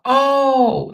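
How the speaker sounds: ordinary talk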